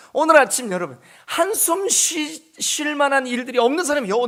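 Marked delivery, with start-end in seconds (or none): 1.3-2.5 drawn-out sighing high pitch; mock heavy sigh. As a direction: let the word melt into a sigh. Enacting a sigh.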